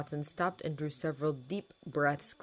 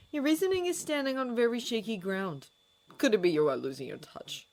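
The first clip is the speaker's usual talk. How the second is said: mocking; 7 semitones higher, 3 dB louder than usual. A narrator's reading. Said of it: "Severus mocked".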